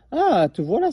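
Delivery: high, loud happy exclamation; excited cry. Acted. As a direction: call out with delight at finding it. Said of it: "s'écria Albert".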